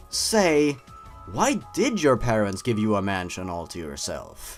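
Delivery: usual speaking voice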